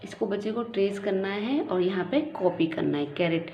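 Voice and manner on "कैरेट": normal talking voice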